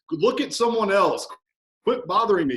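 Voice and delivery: high, loud mock annoyance; client's banter. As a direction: shoo the coach off in jest. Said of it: "a little bit of banter between the coach and client".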